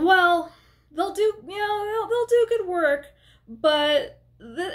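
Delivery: put-on a tipsy old regular vouching grudgingly; barfly voice. Barfly voice retold.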